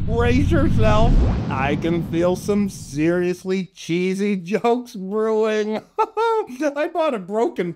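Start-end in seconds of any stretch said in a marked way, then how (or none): none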